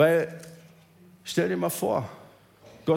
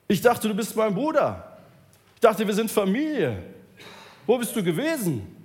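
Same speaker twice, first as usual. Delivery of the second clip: higher, louder hurt accusation; angry shouting; 6 semitones higher, 3 dB louder than usual. Acted. Healed man shouts at his brother, angry. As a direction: yell at the brother who let you down. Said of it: "Und er wird auf einmal sauer".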